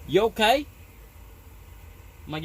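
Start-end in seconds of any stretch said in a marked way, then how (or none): 0.1-0.6 lightly voiced coworker with a speech impediment, a bit higher and louder; a hint of a tall lanky coworker checking in; impression of Slim. Tall coworker asks the hurt narrator if he's okay, lightly voiced. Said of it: "Gonna do my best impression".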